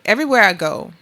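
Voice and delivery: ordinary delivery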